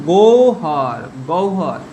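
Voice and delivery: গৌহর said as gou-har; correct pronunciation demo. Shown as a demonstration. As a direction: say গৌহর with an "ou" glide before har. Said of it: "তাহলে কি বলবো আমরা উচ্চারণ". (গৌহর-এ গ-এর পরে ও-উ ধ্বনি, "গোউহর")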